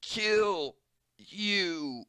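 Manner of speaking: high, strained snarl of a threat; patient threatening the doctor; menacing threat. Acted. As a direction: spit it out like a cornered man.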